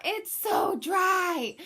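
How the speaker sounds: reliving her yell during labor. Retold or imitated. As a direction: cry it out in desperation. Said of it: "at one point I yelled out".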